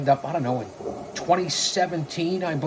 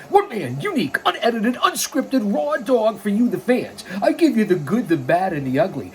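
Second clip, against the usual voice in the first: high-pitched, punchy announcer build, easing down at the end; wrestling promo hype; 5 semitones higher than usual. Acted. Wrestling manager hyping the show to the fans, punchy and high.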